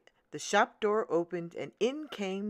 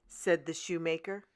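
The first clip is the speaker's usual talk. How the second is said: usual speaking voice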